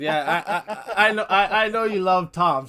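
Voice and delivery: real amused laugh in the voice. Real, not acted. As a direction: get the words out while laughing. Amused by the shouted amens.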